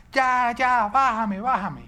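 high, loud plea to get off; loud mock wailing of a child; imitated child's screaming. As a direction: mimic a small boy yelling to be let down. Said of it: "él empezaba a gritar".

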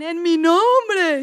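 slow, loud, crying words; imitating the divorced woman's tearful reply; tearful answer. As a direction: retell the woman sobbing out her answer. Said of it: "Y eso lloraba y lloraba".

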